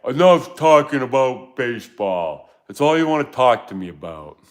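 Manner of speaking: imitating wife. Husband mimics wife fed up with baseball talk, mock complaint. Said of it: "Laura says every day".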